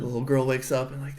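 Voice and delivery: usual speaking voice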